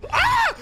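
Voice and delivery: sudden high shriek; startled scream. Real, not acted. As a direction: shriek in surprise. Sudden scare.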